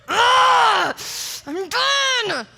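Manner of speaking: high, loud exasperated shout; hammed-up scream of frustration; frustrated scream. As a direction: explode and give up. Retold screw frustration outburst.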